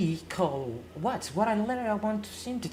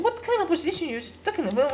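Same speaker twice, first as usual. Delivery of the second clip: excited question; 11 semitones higher, 5 dB louder, much faster than usual. Acted. One man playing both sides.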